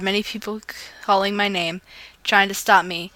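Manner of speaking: ordinary delivery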